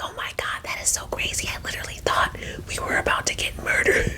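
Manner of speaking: whispered, fast and breathless to her friend; hushed whisper. Retold or imitated. To her friend in the restroom mirror, a rushed whisper. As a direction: whisper it so the guide can't hear.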